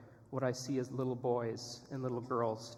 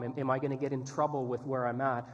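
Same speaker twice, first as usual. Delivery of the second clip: usual speaking voice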